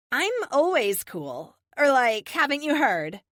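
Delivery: put-on high, loud Valley Girl with drawn-out words; Valley Girl accent. A narrator's reading. Teammate boasting she's cool, Valley Girl accent. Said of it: "says with her Valley Girl accent".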